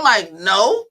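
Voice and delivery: usual speaking voice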